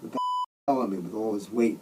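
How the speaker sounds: ordinary delivery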